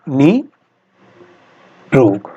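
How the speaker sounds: "निरोग" stretched out very slowly; slow dictation. Shown as a demonstration. Teacher to students, dictating "निरोग" very slowly. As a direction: draw out "निरोग" like dictating a spelling. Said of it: "निरोग को कैसे लिखते हैं".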